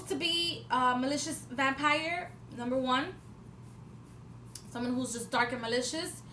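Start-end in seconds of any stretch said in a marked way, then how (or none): none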